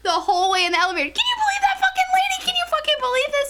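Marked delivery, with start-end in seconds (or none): 1.1-3.5 very high, rapid-fire outrage; shrieky, fast imitation of yelling; ranting yell. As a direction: rant like a drunk, indignant friend. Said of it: "she's yelling".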